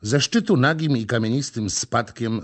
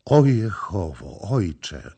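frightened cry; slower than usual. A narrator's reading. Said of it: "krzyknęła przerażona".

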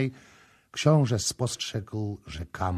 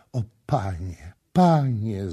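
rising, louder cry of relief; grateful cry; 3 semitones higher, 7 dB louder than usual. A narrator's reading. Kama falling at the prince's feet, crying out in thanks. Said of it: "zawołała, upadając mu do nóg".

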